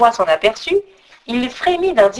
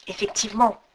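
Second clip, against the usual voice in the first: plain reading voice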